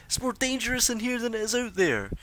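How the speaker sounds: a woman yelling from the outer edge; screaming woman. Acted. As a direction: shriek it from the edge of the crowd. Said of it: "a lady's voice screaming out".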